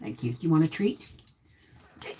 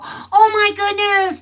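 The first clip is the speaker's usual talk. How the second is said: high, loud mock cooing; hammed-up stranger delight; imitating gushing strangers; 11 semitones higher, 5 dB louder than usual. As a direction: mimic strangers fawning over the dog. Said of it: "would walk right up and go".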